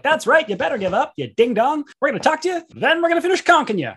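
high, loud gloating taunt; gloating hero. Acted. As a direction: crow it over a beaten foe. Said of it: "we're like".

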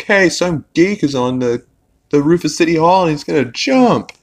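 high, urgent, breathless news; a guy bursting in with urgent news; urgent alarm. Retold or imitated.

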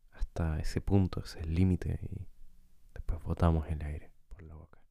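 calm guide with long, unhurried pauses; guided meditation. Acted. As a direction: lead listeners gently through breathing, leaving space between phrases. Guía una meditación de respiración. (meditación guiada, tranquila y pausada)

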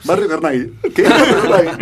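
high, loud words through laughter; helpless real laughter breaking into the words; laughing. Real, not acted. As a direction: crack up while saying it. Cracking up over a name.

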